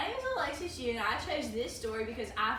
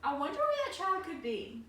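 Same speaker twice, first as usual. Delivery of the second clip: worried mother; 6 semitones higher than usual. Acted. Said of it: "she said to herself".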